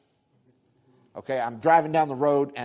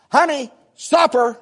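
higher, louder, slow call for supper; wife hollering; 9 semitones higher, 8 dB louder, slower than usual. Retold or imitated. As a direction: call him to the table across the house. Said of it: "your wife hollers at you".

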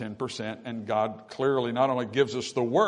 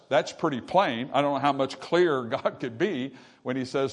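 ordinary talk

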